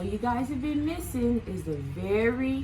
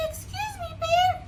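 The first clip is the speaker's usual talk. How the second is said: much higher, tiny sheep voice; timid Sheep. Acted. Sheep offering the pillow to Bear, tiny high voice. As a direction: ask very sweetly and shyly. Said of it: "she said".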